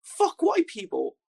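high, loud brash rant, slow; hammed-up angry recruit voice; imitating the American jihadi. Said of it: "And he goes".

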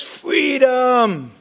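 drawn-out, high-pitched mock battle cry; hammed-up heroic shout, falling at the end; Mel Gibson yell. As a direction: imitate a rallying movie yell, pitched well up. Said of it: "Mel Gibson yells".

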